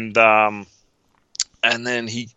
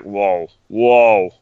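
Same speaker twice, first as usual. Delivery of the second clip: drawn-out, louder whoas in a changed voice; stunned whoa; 4 dB louder, much slower than usual. Retold or imitated. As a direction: act out being floored by the reveal. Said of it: "and you're just like".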